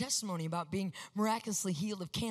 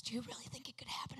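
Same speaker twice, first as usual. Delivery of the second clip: whispered and much softer, a little quick; hushed wonder; 14 dB quieter, faster than usual. Retold or imitated. Mom asking her daughter in awe, a soft whisper. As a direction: ask it breathless with awe.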